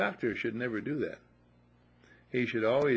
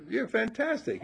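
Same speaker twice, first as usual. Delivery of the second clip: quoting Brian; 11 semitones higher than usual. Retold or imitated. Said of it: "He says".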